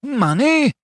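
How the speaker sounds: very high incredulous cry; scandalized, high-pitched outburst; outraged cry. A narrator's reading. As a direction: cry the word in indignation. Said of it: "cried Fetz".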